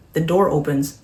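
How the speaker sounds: ordinary delivery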